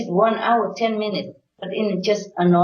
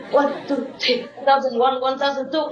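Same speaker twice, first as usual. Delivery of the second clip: laughing countdown; 5 semitones higher than usual. Pretend. Mock quick meditation countdown.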